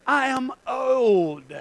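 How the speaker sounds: drawn-out, weary protest; slow complaint. Acted. Preacher replaying the old man's excuse, slow complaint. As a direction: stretch each word out in protest.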